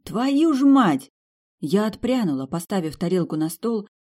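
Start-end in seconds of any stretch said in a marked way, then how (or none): 0.0-1.1 much higher, louder, slow outburst of alarm; shocked curse. Acted. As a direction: blurt it out in horror at the whip. Narrator sees a horsewhip. (испуганное восклицание при виде кнута, высоко и громко)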